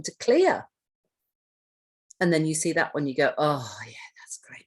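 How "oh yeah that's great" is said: whispered, breathy relief; relieved sigh. Acted. Trainer acting someone seeing a cleared room, relieved whisper. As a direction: exhale the words as if the tension drops away. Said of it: "you just feel relief".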